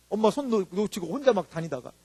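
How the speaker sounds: ordinary delivery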